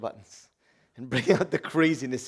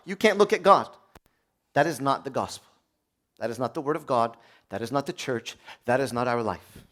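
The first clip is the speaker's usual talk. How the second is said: ordinary delivery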